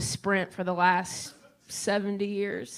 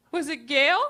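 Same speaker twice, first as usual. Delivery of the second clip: higher, louder unsure guess; hopeful mom guess; 6 semitones higher, 6 dB louder than usual. Retold or imitated. Mom guessing her childhood friend to Sprint, hopeful higher.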